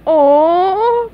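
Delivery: loud, high, drawn-out whine of letdown; disappointed. Acted. Patrick hears they must split up, disappointed. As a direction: stretch it into a big disappointed oh.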